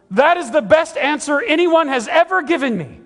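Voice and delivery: loud, high-pitched drill sergeant impression. Drill sergeant answering Forrest Gump, loud imitated bellow. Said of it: "the drill sergeant is there".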